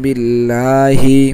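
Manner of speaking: বিল্লাহি chanted very slowly with a long held ল্লা; বিল্লাহি sung out slow like tajweed recitation; Quranic recitation. Shown as a demonstration. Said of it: "একসাথে পড়লে কিভাবে হবে?".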